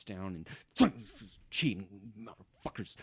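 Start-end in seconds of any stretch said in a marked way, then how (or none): 1.5-3.0 frustrated halfling muttering to himself; frustrated grumbling. Acted. Halfling packing his pony to leave, frustrated muttering. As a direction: grumble it under the breath, frustrated. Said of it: "just frustratedly".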